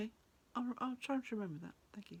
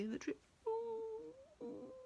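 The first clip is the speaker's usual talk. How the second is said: high tree moan; 12 semitones higher, 6 dB quieter than usual. Acted.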